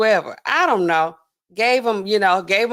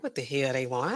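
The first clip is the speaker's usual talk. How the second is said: lower, softer grumble; inner voice; 9 semitones lower, 10 dB quieter than usual. Retold or imitated. Her annoyed inner voice toward coworkers. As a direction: grumble it under your breath. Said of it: "In my head".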